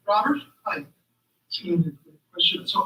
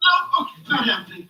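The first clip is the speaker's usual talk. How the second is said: panicked refusal; 4 semitones higher, 6 dB louder than usual. Acted. Balks at the MRI table.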